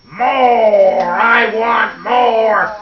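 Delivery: loud, high, greedy holler. Acted. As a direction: holler it with long, stretched vowels. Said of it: "And he cried".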